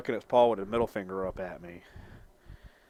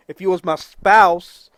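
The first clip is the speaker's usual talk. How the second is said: loud, slow, high mock threat; mock threat shout; 7 semitones higher, 13 dB louder, slower than usual. Rapping a joke threat at the cat, loud and slow. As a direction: bark the rhyme as a joke. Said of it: "I'm kidding".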